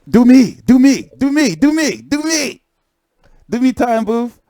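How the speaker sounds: a hint of high playful chant; mock pleading. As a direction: playfully beg "do me".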